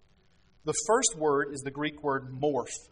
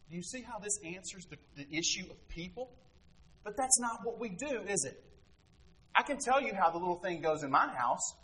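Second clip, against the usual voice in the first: usual speaking voice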